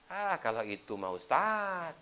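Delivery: loud, high, drawn-out brush-off; dismissive grumble. Acted. Man rejecting the ustaz's advice on getting rich, drawn-out dismissal. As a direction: stretch it out as an acted protest.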